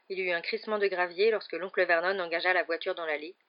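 plain reading voice

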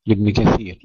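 normal talking voice

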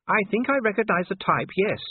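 high, knowing wifely irony; ironic. A narrator's reading. Sybil answers Vimes's rant about stubborn fighters, ironic. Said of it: "with an irony that failed".

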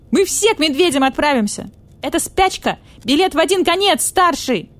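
high, loud panicky wail; hysterical. Acted. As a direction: panic like it's the end. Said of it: "Прекрати истерику".

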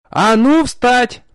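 loud, high, slow yell; barking shout. A narrator's reading. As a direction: bark the order right in his ear. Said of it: "гаркнула у меня над ухом".

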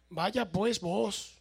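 Salvadoran way of speaking. Retold or imitated. Quoting the Salvadoran brother's testimony, slow Salvadoran accent. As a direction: draw it out the Salvadoran way. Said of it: "Eso es salvadoreño".

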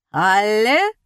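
loud, rising showman's cue; gleeful call. A narrator's reading. Magician rejoicing before the applauding crowd, gleeful call. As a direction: call out in triumph, swooping up. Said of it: "радовался Жиль".

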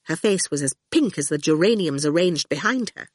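ordinary delivery

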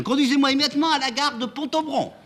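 very high, loud shrill order; shouting. Acted. Amanda jumps into the taxi, shouting the destination. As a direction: cry out the destination urgently. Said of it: "en criant".

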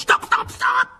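high, loud frantic cries; desperate high yell; panicked shouting. Acted. Ron desperately trying to halt car.

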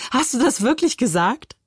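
high, lilting mocking question; amused disbelief. A narrator's reading. Brother laughing at his sister's choice of word, derisively incredulous. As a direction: tease with exaggerated incredulity. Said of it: "lachte Moritz".

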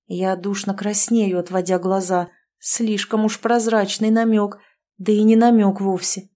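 ordinary delivery

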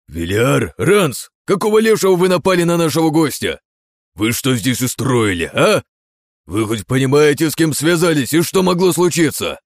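loud, high scolding; angry scolding father. Acted. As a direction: scold the sons angrily. Father scolds his sons.

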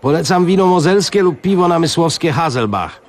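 a high, fawning exclamation; bow and chirp it up high like a servile waiter; eager waiter exclaiming. Acted. Waiter offers drinks.

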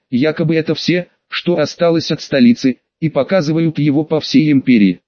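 ordinary delivery, nothing marked

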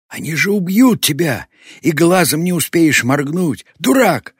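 high, loud exasperated scolding; vexed shout. A narrator's reading. Girl warns the old man he will be killed, exasperated shout. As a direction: shout a furious warning at a fool. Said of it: "досадливо сказала Зора".

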